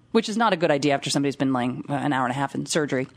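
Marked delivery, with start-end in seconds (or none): none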